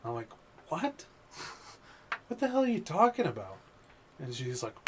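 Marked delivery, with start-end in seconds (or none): 0.7-3.6 high, baffled disbelief; imitating his own stunned reply; incredulous what. Pizza driver retelling his reply to a strange customer, high incredulous.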